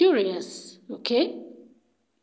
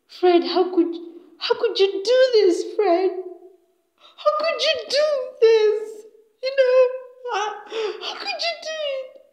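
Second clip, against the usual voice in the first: high, wailing mock sob; ugly-cry sobbing; 12 semitones higher than usual. Harriet looking at Fred, acted ugly crying. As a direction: wail it higher and higher, breaking into hammed-up sobs. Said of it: "she's sobbing that ugly girl cry".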